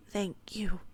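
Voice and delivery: understated tearful thanks; cracking voice. A narrator's reading. Narrator tearfully thanks Ophelia, voice cracking slightly. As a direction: say thanks as if close to tears. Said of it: "My voice is cracking".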